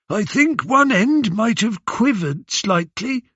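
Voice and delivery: high, innocent helpful voice; helpful old beggar. A narrator's reading. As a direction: sound meek and eager to help. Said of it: "trying to be helpful".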